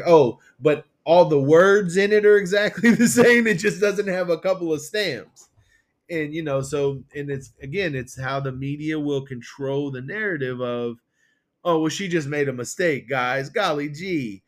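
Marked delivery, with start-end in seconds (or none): 0.0-5.5 sarcastic mock. Sarcastic mock of official excuse. 11.6-14.4 mock innocence. Mocking the media's spin.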